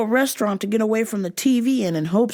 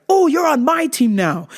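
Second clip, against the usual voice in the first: louder, higher eager burst; excited dad; 7 semitones higher, 6 dB louder than usual. Retold or imitated. Dad claiming child for his side, overeager joy. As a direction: pounce with mock delight. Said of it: "right away, it's like".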